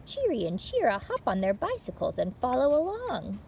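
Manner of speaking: ordinary delivery, nothing marked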